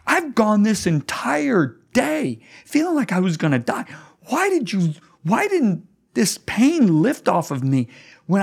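high, pained questioning of God; desperate plea. Retold or imitated. Pastor voicing his complaint to God on the plane, high anguished. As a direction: pour out the pain in a high strained voice.

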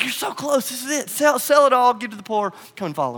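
urgent loving plea. Acted. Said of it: "Jesus, like".

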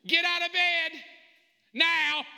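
high, loud wake-up yell; parent's shouted order. Acted. Father rousting daughters from bed, shouted command. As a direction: holler it with no room for argument. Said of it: "This is how it goes in my house".

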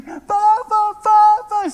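loud, high mock child's cry; overjoyed granddaughter calling out. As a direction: call out like a child running to Grandpa. Granddaughter spots grandfather at airport.

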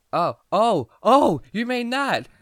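high, slow stammering surprise; dawning realization. Retold or imitated. Teen self realizing the cashier meant lighters, stammering surprise. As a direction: stretch out the penny dropping.

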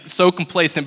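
ordinary talk, nothing marked

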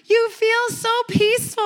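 hammed-up gushing seatmate; mock gushing. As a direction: gush like the nervous seatmate. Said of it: "she's like".

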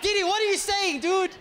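high, loud comic outburst; exasperated protest. Retold or imitated. Sick man pleading with zealous friend, loudly exasperated. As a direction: protest in disbelief, voice shooting up.

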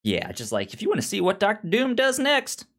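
normal talking voice

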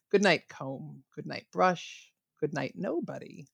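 soft, slow bedtime story reading. Shown as a demonstration. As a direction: read it gently like tucking a child in. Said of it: "closing us out with the final lines of Goodnight Moon".

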